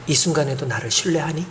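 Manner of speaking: hushed, soft question brushing past the ear; soft voice of God. Retold or imitated. God speaks gently into the grieving pastor's ear, hushed. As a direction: breathe 이 순간에도 나를 신뢰하니 softly, barely voiced. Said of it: "귀를 이렇게 스치면서 음성을 들려주시는".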